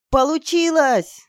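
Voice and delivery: high, drawn-out triumphant cry; yelling in triumph. Acted. Girl celebrating the spell working, yelling. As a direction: shout the success, stretching it. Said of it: "заорала я".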